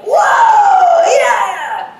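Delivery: high, loud mock cheer from the sideline; obnoxious sports dad cheer. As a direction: ham up a loudmouth dad yelling at a girls' soccer match. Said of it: "mimic him whenever he made these really obnoxious comments".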